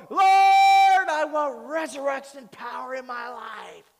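loud, high-pitched mock-fervent plea, first word drawn out; believer crying out to God. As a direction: belt it out like an over-eager prayer. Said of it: "Most of us go".

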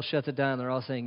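normal talking voice

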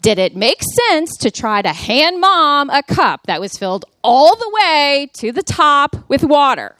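high, loud, exasperated scolding; snapping at him. Retold or imitated. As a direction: re-enact a mom snapping at her kid. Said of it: "I snapped at him".

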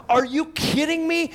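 high, loud mock disbelief; incredulous comedian. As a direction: ham up the comic's outrage. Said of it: "he goes on and says".

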